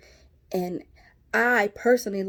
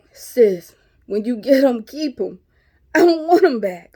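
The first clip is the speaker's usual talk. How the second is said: emphatic; 4 semitones higher, 9 dB louder than usual. Real, not acted. Emphatic advice to women.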